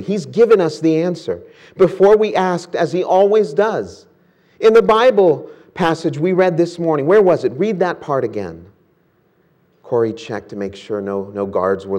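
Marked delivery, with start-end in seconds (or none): 0.0-8.6 higher, eager and quick; bubbling over with the idea; excited. Acted. Betsy excitedly tells Corrie about the answer. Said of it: "exclaimed excitedly".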